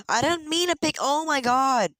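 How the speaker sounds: very high, loud panicked cry; startled shout. Real, not acted. Gamer accidentally picking a fruit, startled shout. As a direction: yelp as the misclick happens. Picks wrong item by accident.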